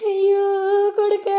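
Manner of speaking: high, loud grieving cry for her son; mother wailing over her dead son. Acted. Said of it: "ఏడవకత్తమ్మా".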